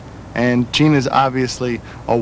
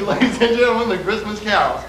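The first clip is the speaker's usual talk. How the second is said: higher, showy announcer intro; mock announcer; 6 semitones higher than usual. Singer mock-announces his bandmates to the camera like a host. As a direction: announce them like a grand show act.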